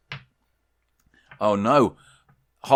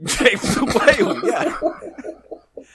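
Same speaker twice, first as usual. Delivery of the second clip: high, laughing through the words; 9 semitones higher, much slower than usual. Real, not acted. Storyteller amused by his own whale pun, laughing. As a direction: say it while cracking up. Teller cracks up at own character.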